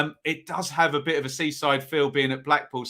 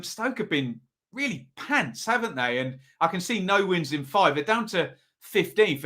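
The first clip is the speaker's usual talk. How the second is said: normal talking voice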